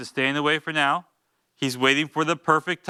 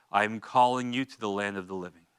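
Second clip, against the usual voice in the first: normal talking voice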